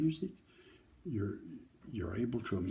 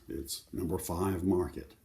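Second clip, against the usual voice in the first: ordinary delivery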